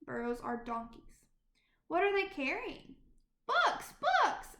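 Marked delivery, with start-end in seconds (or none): 3.5-4.6 high, loud thrilled shout; delighted child calling out, much higher; excited cry. Acted. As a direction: burst out with joy at the books. Children spot the books.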